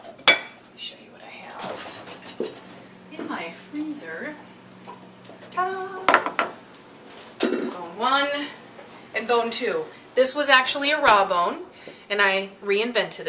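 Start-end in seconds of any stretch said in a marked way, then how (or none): 0.0-4.4 slow teasing whisper; secretive whisper. Acted. Whispering to viewers before revealing frozen bones. 5.5-8.5 bright high showman's ta-da; ta-da reveal. Acted. Showing viewers her frozen bones with a high ta-da.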